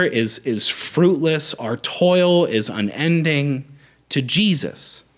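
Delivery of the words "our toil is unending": usual speaking voice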